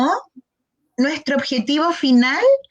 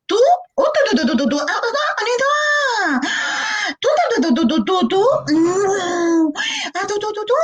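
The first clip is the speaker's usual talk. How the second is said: put-on high, excited baby babble of tu tu tu; imitating toddler babble; 8 semitones higher than usual. Speech therapist imitating a child with apraxia babbling excitedly. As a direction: babble like a small child telling a whole story.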